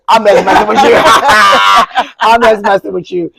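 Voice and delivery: loud, high laughing through the words; laughing tease. Real, not acted. Host teasing her co-host, laughing loudly and high. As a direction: crack up while teasing your friend.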